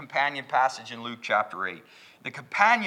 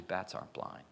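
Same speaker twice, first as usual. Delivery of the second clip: normal talking voice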